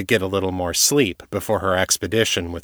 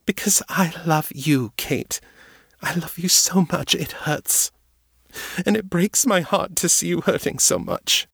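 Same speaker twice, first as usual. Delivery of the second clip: high, tearful declaration of love; weeping voice, much higher; 10 semitones higher than usual. A narrator's reading. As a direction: speak through tears, overwhelmed. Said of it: "her voice was thick with tears".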